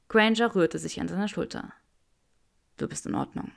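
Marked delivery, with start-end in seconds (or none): 2.8-3.5 low hushed murmur. A narrator's reading. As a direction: murmur it reassuringly. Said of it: "raunte Draco".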